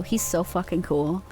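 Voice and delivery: usual speaking voice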